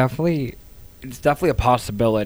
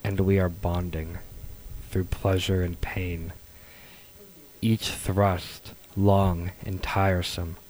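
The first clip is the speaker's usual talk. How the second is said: seductive voice; slower than usual. Pretend. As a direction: draw each line out, sultry and slow. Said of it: "Are you gonna do a seductive voice for us?".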